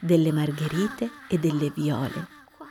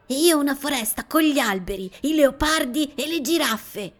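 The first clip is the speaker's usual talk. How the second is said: put-on excited child voice; 7 semitones higher, 5 dB louder than usual. A narrator's reading. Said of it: "dice Gianni".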